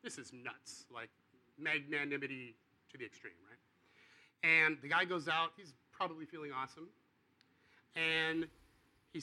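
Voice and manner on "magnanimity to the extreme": normal talking voice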